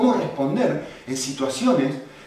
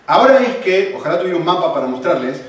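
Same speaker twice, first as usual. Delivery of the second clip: full-force preaching; 6 semitones higher, 12 dB louder than usual. Acted. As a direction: preach it out loud to the whole church. Preaching to the congregation.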